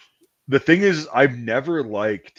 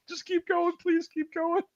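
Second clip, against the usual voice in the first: mock pleading; 5 dB quieter than usual. Fleeing boys pleading in car.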